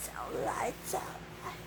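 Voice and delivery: breathy whisper, no voice; whispered lyric. Acted. Whispering a song line to the listener. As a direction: whisper it like a secret.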